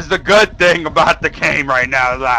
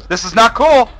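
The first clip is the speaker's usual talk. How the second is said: loud, high protest; rising alarm; 9 semitones higher, 8 dB louder than usual. Real, not acted. Player protesting to the horror game, alarmed and loud. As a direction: blurt it out as dread takes over.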